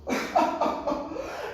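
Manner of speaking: high, breathy burst of laughter; theatrical laugh. Acted. Grandfather amused by grandchild, hearty laugh. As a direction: laugh off the question.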